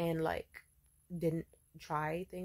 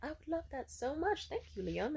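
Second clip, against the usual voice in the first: mock delight; 9 semitones higher than usual. Her delighted reply to the gift.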